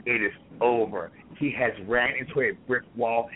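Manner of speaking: usual speaking voice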